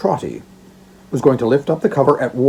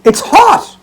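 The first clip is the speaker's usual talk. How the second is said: high, loud delighted cry; cry of rapture; 11 semitones higher, 9 dB louder, slower than usual. Acted. Old father sniffing the basket, crying out in rapture. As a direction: burst out with joy at the smell. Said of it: "cried out in rapture".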